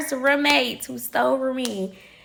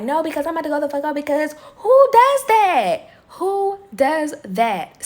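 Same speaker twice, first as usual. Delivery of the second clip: heated; 4 semitones higher than usual. Retold or imitated. Friends trying to calm her.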